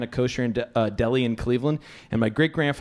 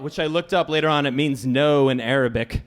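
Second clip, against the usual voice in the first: usual speaking voice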